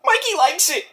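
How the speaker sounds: high, put-on goofy old-wrestler voice; Mick Foley impression. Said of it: "What do you think about that, Mick".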